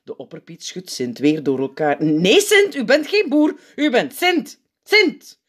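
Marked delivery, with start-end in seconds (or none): 2.0-5.4 high, loud, exasperated insistence; dringend corrigerend. A narrator's reading. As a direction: shout sense into him. Opperpiet shakes and corrects Sint. (luid en dringend)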